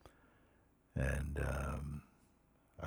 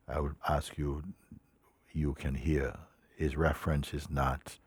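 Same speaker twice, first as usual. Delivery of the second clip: ordinary talk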